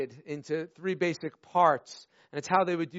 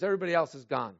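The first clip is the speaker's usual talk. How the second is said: ordinary talk, nothing marked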